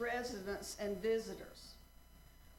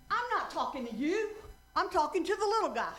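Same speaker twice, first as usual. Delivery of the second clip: much higher, louder, fast indignant retort; offended woman's louder, higher punchline; angry punchline; 7 semitones higher, 8 dB louder, faster than usual. Retold or imitated. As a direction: snap it at the dummy, hammed-up heckler.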